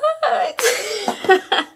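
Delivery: high, loud giddy laugh. Real, not acted. Vlogger reading scent notes, giddy laughing. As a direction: burst out laughing.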